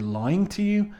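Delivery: high rising voice of disbelief; acted incredulous question. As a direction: query it in shocked disbelief, pitch climbing. Acted phone call, accused of lying.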